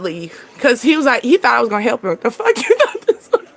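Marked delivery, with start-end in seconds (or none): 2.2-3.5 high, laughing scoff; laughing disbelief. Real, not acted. Scoffing at the date who expected help, laughing in disbelief. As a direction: laugh it off incredulously.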